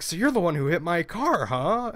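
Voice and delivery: lightly put-on voice of an accusing caller; imitated angry caller. Man retelling an irate stranger's phone call, imitating an accusing caller. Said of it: "trying to reenact the gentleman's voice".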